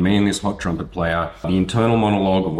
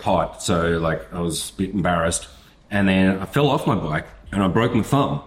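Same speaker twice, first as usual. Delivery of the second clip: usual speaking voice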